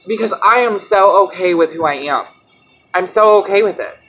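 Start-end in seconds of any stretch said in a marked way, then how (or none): none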